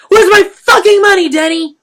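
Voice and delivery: a dealer screaming at gunpoint; furious yell. A narrator's reading. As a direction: scream the demand in his face. Dealer repeats demand at gunpoint.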